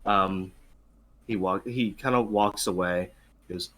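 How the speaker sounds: usual speaking voice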